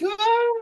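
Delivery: very high imitated crowd "ah"; crowd's cheer. Crowd reacts to the machine, high imitated cheer. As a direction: imitate a distant crowd in falsetto. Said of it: "you hear the crowd's like".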